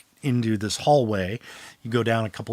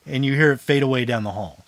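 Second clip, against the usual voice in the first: usual speaking voice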